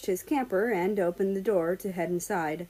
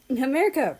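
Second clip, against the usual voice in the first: loud call across the yard, higher and louder; bright excited hail from a distance; 6 semitones higher, 5 dB louder than usual. Acted. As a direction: call his name to get his attention. Minnesota calling them over.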